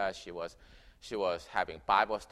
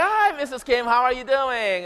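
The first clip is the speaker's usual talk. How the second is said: high, bright mock American neighbor greeting; the American neighbor opens the door to Mrs Kim; neighbor's cheery greeting; 13 semitones higher, 11 dB louder than usual. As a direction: sing out a friendly hello at the door. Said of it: "it's like".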